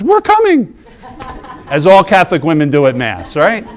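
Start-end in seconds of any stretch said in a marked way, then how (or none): 0.0-0.8 very high, loud comic cry; eager mock shout. Wife jumps up from the pew at mass, excited mock shriek. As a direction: imitate an over-excited volunteer calling out. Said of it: "jumps up out of the pew and goes".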